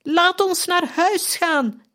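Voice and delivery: very high, slow, desperate shriek; slow high anguished scream. A narrator's reading. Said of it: "gilde opnieuw".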